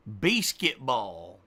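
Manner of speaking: "Basketball" slowly as "bay-skit-bawl"; odd "basketball" pronunciation. Shown as a demonstration. Streamer testing silly versions for viewers, slow "bay-skit-bawl". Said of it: "How do you say it?".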